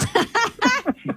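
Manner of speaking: high, short burst of laughter; embarrassed laugh. Real, not acted. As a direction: laugh at your own mishap. Laughs at own voice crack.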